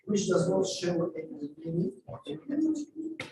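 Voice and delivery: usual speaking voice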